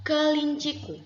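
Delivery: title announced high and ringing; poem title recited. Shown as a demonstration. Teacher announcing the poem's title to her class, recital style. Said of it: "begitu ya contoh membaca puisi".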